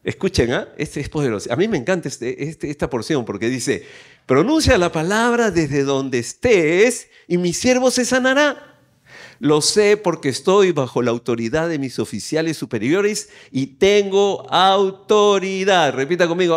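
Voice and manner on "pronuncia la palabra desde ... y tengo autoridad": emphatic reading, drawn out on key words; scripture proclamation. A narrator's reading. Preacher reading the officer's reply to Jesus, emphatic proclamation. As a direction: proclaim the officer's faith to the congregation. Reads Bible aloud to congregation.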